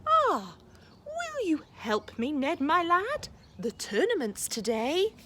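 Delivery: a hint of a jolly dad; cheery dad. Dad voiced in story.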